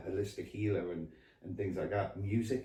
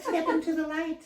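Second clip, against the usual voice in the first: mock medium; 5 dB louder than usual. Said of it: "With my terrible impression".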